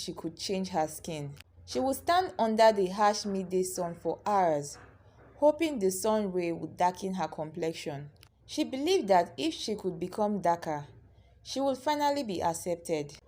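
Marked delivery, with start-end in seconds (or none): none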